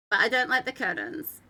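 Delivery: ordinary delivery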